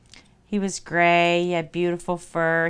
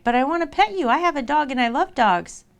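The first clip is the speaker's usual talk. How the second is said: put-on higher, eager child voice; pleading little girl; 5 semitones higher than usual. Little girl begging the wolf to let her pet him, pleading. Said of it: "the little girl was like".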